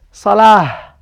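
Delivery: "salah" alone, drawn out to a final h; ends with ha. Shown as a demonstration. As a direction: say "salah" slowly, closing on h instead of t. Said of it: "if you would like to say it just".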